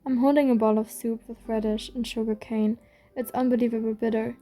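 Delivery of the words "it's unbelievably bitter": ordinary delivery, nothing marked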